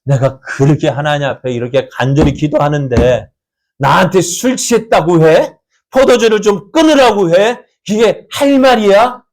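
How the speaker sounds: imagine Hannah hurt and bristling; indignant protest. Retold or imitated. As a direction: Hannah snapping back at the priest, indignant. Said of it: "한나도 어땠을까요?".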